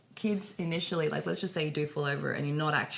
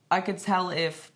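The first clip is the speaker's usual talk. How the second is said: usual speaking voice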